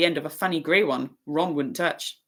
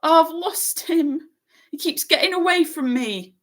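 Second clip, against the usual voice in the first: high, louder, slow wail; mild wail of despair; the boy wailing over his lost toad; 12 semitones higher, 4 dB louder than usual. A narrator's reading. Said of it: "he wailed".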